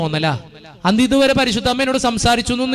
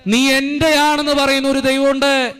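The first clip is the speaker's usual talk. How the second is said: raised, insistent preacher's voice, building line by line; fervent preaching; 8 semitones higher, slower than usual. Real, not acted. Priest urging the congregation in church, full fervent preaching. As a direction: drive each line home to the faithful.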